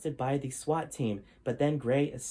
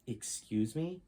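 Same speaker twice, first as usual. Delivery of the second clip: softer, hesitant "Excuse me?"; weak, soft reply; 6 dB quieter, slower than usual. Acted. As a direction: offer it weakly, barely daring. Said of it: "Gray instead weakly offered".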